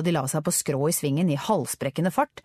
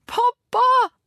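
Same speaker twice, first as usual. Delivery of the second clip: very high, loud cry for a parent; tearful shout; 7 dB louder than usual. A narrator's reading. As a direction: cry out, choking back tears. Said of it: "ropte Richard med gråtkvalt stemme".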